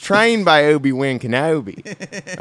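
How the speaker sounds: mock Australian accent. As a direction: play Anakin as a cheerful Aussie bloke. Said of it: "in an Australian accent".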